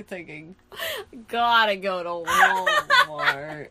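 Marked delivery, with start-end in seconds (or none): 1.3-3.7 slow, loud, breaking into laughter; laughing mockery. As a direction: drawl it out, cracking up on the store name. Mocking the character's thought, laughing.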